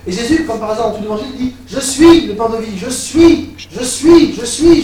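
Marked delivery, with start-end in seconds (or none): 1.7-4.8 "Je suis" hammered louder and higher; preaching at full force. Acted. Preacher proclaiming Jesus' words to the congregation, loud.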